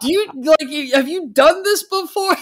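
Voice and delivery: loud, high-pitched incredulous question; mock exasperation. Host challenges guest about podcast manners, mock exasperated. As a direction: push it up high and loud in disbelief.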